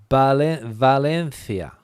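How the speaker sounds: Valencia with th, "Valenthia", after a false start; Castilian th. Shown as a demonstration. Teacher lists Spanish places, Valencia with th. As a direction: slowly say Valencia's C like th in think. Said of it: "The th is exactly the same sound".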